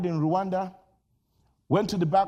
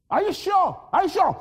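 doubting servant; 9 semitones higher, 4 dB louder than usual. Acted.

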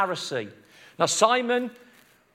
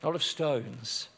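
low, soft, with long pauses; quiet, intimate preaching; hushed solemnity; 7 semitones lower, 8 dB quieter than usual. A narrator's reading. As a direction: paint the scene gently and reverently. Solemn image of kneeling woman.

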